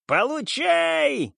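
high, frantic shriek; hysterical shouting. A narrator's reading. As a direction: shriek it while firing wildly. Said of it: "истерично кричал главарь".

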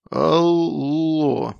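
put-on slurring like a drunk; drawn-out, tipsy "hello"; slurred drunk voice. A narrator's reading. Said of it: "заплетающимся языком проговорил он".

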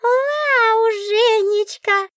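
very high, delighted squeal; the book squeals with joy; joyful book. A narrator's reading. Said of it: "радостно воскликнула книга". (радостно, очень высоким голосом книги)

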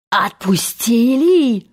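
slow, drawn-out cry of outrage; outraged yell. A narrator's reading. Hook hears the captive was released, outraged yell. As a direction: stretch the word in outraged disbelief. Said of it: "заорал Крюк".

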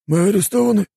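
high-pitched puzzled query; surprised question. A narrator's reading. Homeless men ask police, high surprised voice. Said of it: "удивились бомжи".

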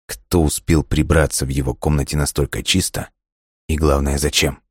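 ordinary delivery

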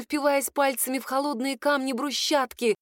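ordinary delivery, nothing marked

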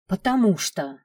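narrated character losing her temper; angry. A narrator's reading. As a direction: snap back in mild anger. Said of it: "разозлилась Маринка".

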